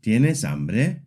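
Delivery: "¿Tienes hambre?" rising sharply on "hambre"; yes-no question melody. Shown as a demonstration. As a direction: ask "¿Tienes hambre?" with the voice lifted at the end. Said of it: "Yes or no question".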